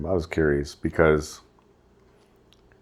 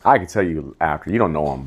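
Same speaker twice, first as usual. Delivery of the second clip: normal talking voice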